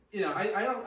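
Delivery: ordinary delivery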